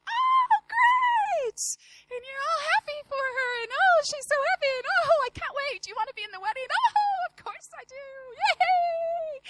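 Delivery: high, loud gushing fake joy; squealing forced delight on the phone; fake excitement. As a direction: gush like you're thrilled for her. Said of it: "you know how you fake it".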